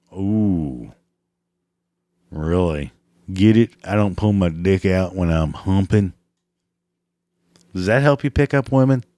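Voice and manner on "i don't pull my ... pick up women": usual speaking voice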